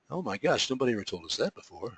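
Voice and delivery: softly voiced aside as an agent; hushed mock amazement. Agent reacting to learning about proper submissions, hushed mock amazement.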